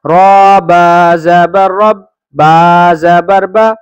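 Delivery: slow, drawn-out letter-by-letter chant; teacher reciting each syllable deliberately; spelling recitation. Acted. Teacher spelling the lesson for children, slow chant.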